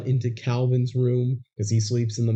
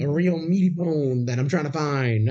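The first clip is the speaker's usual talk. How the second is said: a lightly voiced doggy man, pitched up; mild imitation of the dog voice from the film; [name] channeling the dog; 6 semitones higher than usual. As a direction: mimic a man talking like an eager dog. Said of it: "they do this voice whenever he's channeling Lucky".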